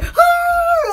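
sudden high yelp of fright; startled scream. Retold or imitated. Hearing a voice in the closet, a startled scream. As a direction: shriek like something spooked you. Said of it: "And I'm like".

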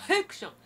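high sharp mock sneeze, heh-kushon. Shown as a demonstration. Teacher imitates a sneeze to show good voicing. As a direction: fake a sneeze to show the onset. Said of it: "くしゃみした時の出し方".

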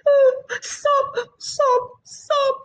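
high sobbing voice; crying. Acted. Bushfowl cries beside her broken nest, sobbing. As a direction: sob it out high and tearful.

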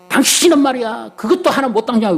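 high, sharp scolding retold; scolding wife. Wife on the train scolding her husband, high and sharp.

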